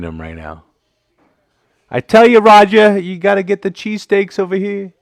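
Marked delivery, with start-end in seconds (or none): 1.9-4.9 loud, high, bad Philadelphia accent; mock Philly accent, shouted high and loud; horrible Philly accent. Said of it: "That was a horrible Philly accent".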